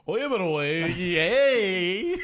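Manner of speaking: high, loud Miami Spanglish parody; mock Miami accent. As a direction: call out in a hammed-up Miami accent. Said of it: "there's the Miami accent".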